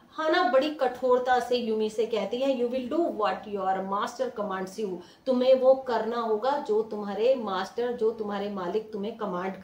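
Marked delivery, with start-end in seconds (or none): none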